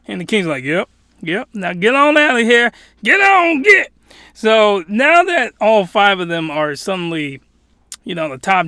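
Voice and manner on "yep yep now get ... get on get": high, loud mock command, building; dismissive shooing. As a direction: shoo them off like a fed-up king. Acted king shoos them away.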